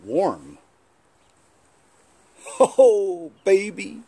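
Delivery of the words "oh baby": hint of a blustery TV husband; a light first go at Kramden's "baby"; Ralph Kramden voice. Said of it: "that's like Ralph Kramden".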